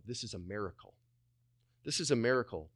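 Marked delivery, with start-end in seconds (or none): 0.0-1.0 low, very soft aside to oneself; hushed awe. Acted. Preacher modelling a pause before prayer, hushed awe. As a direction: pause and marvel quietly, low.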